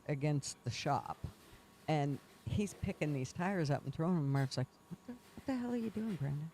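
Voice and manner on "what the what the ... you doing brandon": high, fast, startled mock outburst; imitating the boss catching him, high and quick; startled yell. Marv startled at tire throwing.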